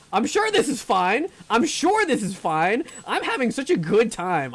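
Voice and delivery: loud, high, strained insistence; frightened forced cheer, pushed high; panicked reassurance. Real, not acted. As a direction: insist it's fine while panicking. Forced cheer while scared in game.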